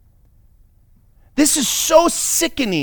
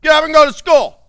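loud, high parental order; acted father's shout; exasperated father; 9 semitones higher, 6 dB louder, faster than usual. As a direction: call out to rouse lazy kids. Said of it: "This is what Solomon experienced with his kids".

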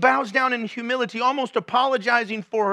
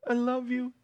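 soft, tender reassurance; tender wife; 3 semitones higher, 5 dB quieter than usual. Retold or imitated. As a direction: breathe it gently to someone in tears. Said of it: "And she just".

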